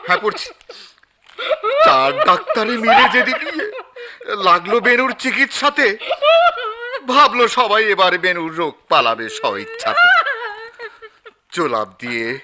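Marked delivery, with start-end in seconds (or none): 1.8-12.4 loud, declaiming verse reading; poem recital. Acted. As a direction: recite it grandly, line by line. Editor reads poem to grieving mother. (উচ্চস্বরে কবিতা আবৃত্তি)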